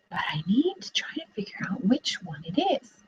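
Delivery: whispered, half to herself; whispered wondering. Real, not acted. Teacher wondering aloud to the class, whispered. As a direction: whisper it like a secret puzzle. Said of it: "teacher thinking aloud".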